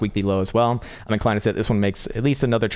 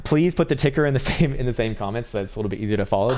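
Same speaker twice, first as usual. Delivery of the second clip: usual speaking voice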